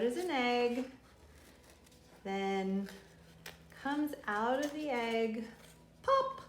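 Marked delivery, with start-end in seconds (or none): 6.0-6.4 high pop. Pretend. Egg hatching sound effect.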